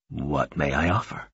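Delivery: low, soft, courteous request; hushed, deep formal voice; formal visitor. Acted.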